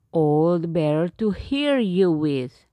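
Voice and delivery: low, loud wolf voice; a growly-toned beast answering; werewolf. Acted. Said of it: "said the wolf".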